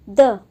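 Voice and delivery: द said loud as a short neutral vowel; a quick uh sound on द; schwa sound demo. Shown as a demonstration. Teacher isolates the schwa in teacher for students. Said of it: "बहुत धीरे से जो".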